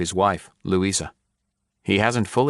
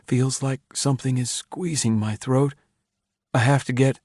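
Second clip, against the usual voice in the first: higher, tight, rising into panic; strained, choking voice; 7 semitones higher than usual. A narrator's reading. Craghorn on the tape, feeling his throat squeezed, panicked and higher. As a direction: sound like something grips your throat.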